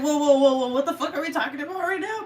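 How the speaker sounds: high, loud burst of playful shock; mock alarm. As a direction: put the brakes on in amused disbelief. Acts out startled reaction to crude comment.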